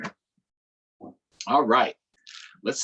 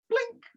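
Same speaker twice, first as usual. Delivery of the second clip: high plink sound. A narrator's reading. Ukulele sound.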